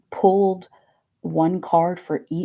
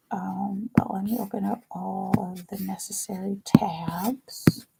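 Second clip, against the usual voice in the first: whisper; 4 dB quieter than usual. Real, not acted. Muttering to herself opening tabs.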